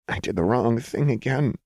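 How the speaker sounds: a touch of upset, muttered; shaky mutter. A narrator's reading. Jinx blaming herself to Lux, shaky mutter. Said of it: "she muttered shakily".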